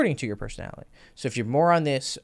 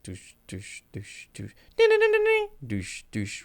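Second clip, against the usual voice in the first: beatbox a repetitive low-grade techno track; techno beat imitation. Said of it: "low grade techno that's like".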